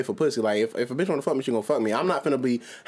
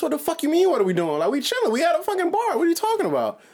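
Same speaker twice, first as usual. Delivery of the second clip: retold indignant comeback, pitched much higher; indignant retort. Man retelling his comeback to a date at the bar, indignant. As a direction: throw it back at her, high and incredulous.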